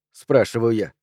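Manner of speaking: ordinary delivery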